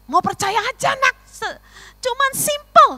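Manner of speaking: put-on high, drawn-out plea as God to a child; imitated divine urging, high and slow. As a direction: coax loudly, voice lifted, stretching the words. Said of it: "Tuhan berkata".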